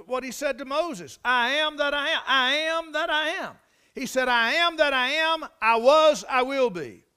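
high, loud, driving preacher's delivery; impassioned preaching. Acted. Preacher quoting God's words to Moses, impassioned preaching. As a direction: hammer out the repeated phrases to a congregation.

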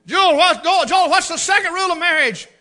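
high, loud drill-sergeant question; loud quizzing call. Retold or imitated. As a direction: fire the question at him on speakerphone. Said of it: "I'd say".

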